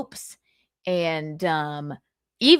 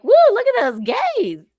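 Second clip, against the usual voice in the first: much higher, louder playful whoop; teasing cheer, pitched way up; mock excited whoop; 6 dB louder than usual. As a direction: squeal it like a hyped-up party girl. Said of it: "and I go, like".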